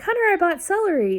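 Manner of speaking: high, fast, cheery call to the son; excited announcement. Retold or imitated. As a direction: announce it proudly.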